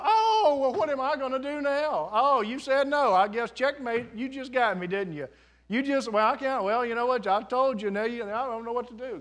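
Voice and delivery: high-pitched, hammed-up dismay; whiny mock surrender with a big high "oh"; mock helplessness. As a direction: play at being flustered and outwitted, loudly. Mocks a flustered, defeated God.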